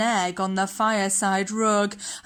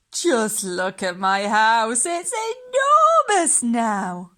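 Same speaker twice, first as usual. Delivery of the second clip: put-on amazed old lady; slower than usual. Old lady marvelling at her roomy house, slow. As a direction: marvel at the space.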